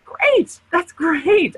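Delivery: high, beaming great; bright cheer. Real, not acted. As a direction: flip the complaint into delight. Cheerful answer to frustration.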